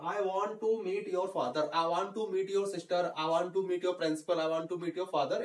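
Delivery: slow, deliberate model sentences; slow drill. Shown as a demonstration. Teacher drilling English sentences for beginners, slowly. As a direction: spell each sentence out for learners. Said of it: "teacher drilling model sentences".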